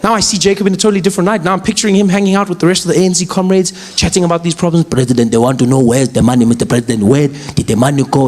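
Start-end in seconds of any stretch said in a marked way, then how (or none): 4.8-8.3 lower comrade voice. Retold or imitated. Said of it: "comrades questioning Zuma".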